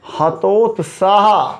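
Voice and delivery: हतोत्साह drawn out slowly, syllable by syllable, ha-to-tsaah; slow correct word. Shown as a demonstration. Teacher dictating the correct word, very slow and clear. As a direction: spell out हतोत्साह slowly for the class. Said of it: "हतोत्साहित नहीं".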